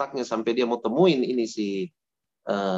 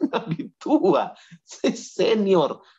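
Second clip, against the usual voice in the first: smug boast; 4 semitones higher, much slower than usual. Acted. Mocking the old prophet's pride.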